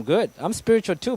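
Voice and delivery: ordinary delivery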